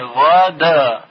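غدا drawn out slowly with the دال read with a fatha; غدا stretched very slowly to show the دال; slow demo of دال. Shown as a demonstration.

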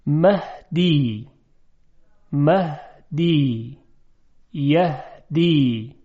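slow مهدی، یهدی with a light breathed h; soft ordinary he. Shown as a demonstration. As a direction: draw out مهدی، یهدی with a plain glottal h. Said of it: "این هی دو چشم است". (تلفظ مهدی، یهدی با های دو چشم، نرم)

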